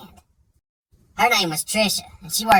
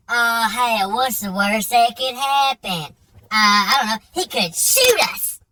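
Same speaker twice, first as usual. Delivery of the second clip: Devin's drawl; 5 semitones higher, 3 dB louder, slower than usual. Retold or imitated. Buddy brushing off the warning, slow mock drawl. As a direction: drag it out like a lazy good old boy.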